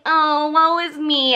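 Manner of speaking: slow mock self-pity; hammed-up whining; whiny mimicry. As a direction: sigh it out like a sulking martyr. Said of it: "He's so whiny".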